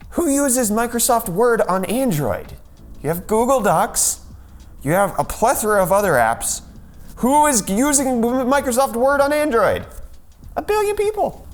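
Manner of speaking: high-pitched incredulous rant. Real, not acted. Host baffled by Word's billion Android installs, incredulous. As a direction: build in disbelief, pitch climbing very high.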